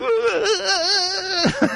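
high squeaky mock sob-laugh; SpongeBob crying. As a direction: wail like a cartoon sponge. SpongeBob crying after rejection.

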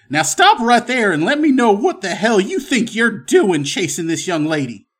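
loud, high commanding voice, easing off at the end; stern, commanding. Acted. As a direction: order them to halt as a stern gunman. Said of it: "stern and commanding voice".

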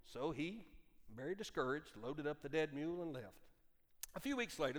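ordinary delivery

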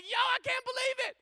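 high, loud shout of amazed disbelief; hammed-up high-pitched yell; excited scream. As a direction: scream it like a third angel who can't contain it. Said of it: "the third one being like".

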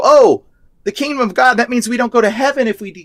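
high, rushed mimicry of naive believers; mistaken Christians. Preacher mimicking many Christians' misreading, high naive voice. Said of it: "a lot of Christians like to go".

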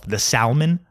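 salmon with a hard L, lifted like a question; mispronounced. Retold or imitated. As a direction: say salmon as SAL-mun, rising. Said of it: "he repeated himself".

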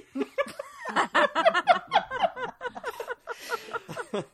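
loud high burst of laughter; a real belly laugh; big laugh. Real, not acted. Host bursts out laughing at the mistake children joke.

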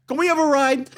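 high-pitched hopeful plea; imitated eager request. Star to van driver, high eager request. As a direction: mimic the star asking for a favour. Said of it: "Chaz goes like this".

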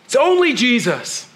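Paul pushing back hard; mild mock frustration; frustrated Paul. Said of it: "Paul was just so frustrated and so upset".

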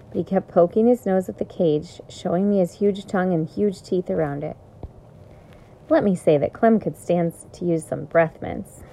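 plain reading voice

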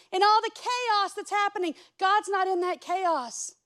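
loud, high, fervent preaching to the congregation; preaching at full force. Acted. Preacher urging his congregation, full-force preaching. Preaching about storms and chaos.

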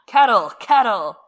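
loud, high chant; crowd shout. Acted. Men banging tables for the kettle, chanting shout. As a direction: shout like a rowdy voting crowd. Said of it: "everyone shouts for the kettle".

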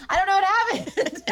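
high, laughing disbelief. Real, not acted. Telling hosts her French accent vanished, laughing.

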